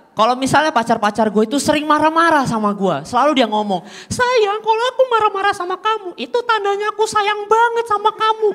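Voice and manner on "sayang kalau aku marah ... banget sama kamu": high, sugary imitation of a girlfriend; mocking girlfriend voice. Comedian imitating his girlfriend, high mock coo. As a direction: mimic the girlfriend cooing her excuse. Girlfriend quoted in stand-up bit.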